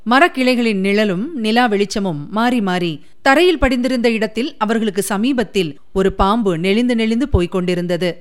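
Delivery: plain reading voice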